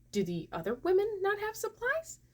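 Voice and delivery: retold disbelief in a high, rising voice; incredulous question. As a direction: climb very high and hang there.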